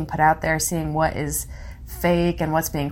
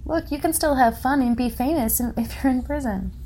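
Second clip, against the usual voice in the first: higher-pitched sarcastic show promo; mock cheerful pitch; 6 semitones higher than usual. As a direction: parody an upbeat sales message. Voicing prison shows' message.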